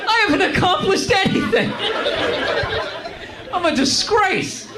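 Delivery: high, laughing mock despair; hammed-up laughing lament; laughing self-pity. Real, not acted. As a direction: wail your failures through laughter.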